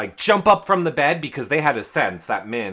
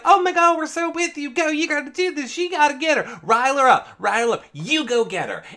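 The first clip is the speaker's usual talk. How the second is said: very high, squealing fake cheerleading; rapid, high-pitched imitation of gushing allies; mock hype squad; faster than usual. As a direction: egg a friend on with gushing fake support.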